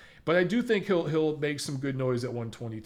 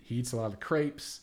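ordinary talk

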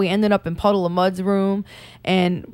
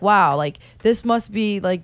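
ordinary talk

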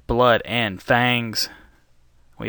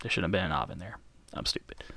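muttered aside; 3 semitones lower, 11 dB quieter, faster than usual. Real, not acted. Self-mocking aside after grammar slip.

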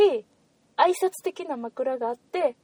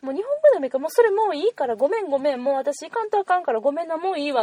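higher, hurried flustered brush-off; imagined flustered refusal; 4 semitones higher, faster than usual. Pretend. Telling the salesman she must go, higher and flustered. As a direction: wave off the salesman, apologetic and rushed. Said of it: "って言って".

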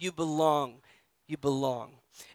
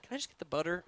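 sheepish quiet ask; 11 dB quieter, faster than usual. Pretend. Asking over people in tight space.

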